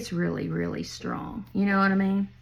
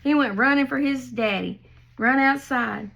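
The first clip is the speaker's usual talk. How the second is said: ordinary talk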